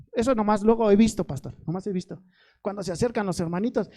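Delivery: ordinary talk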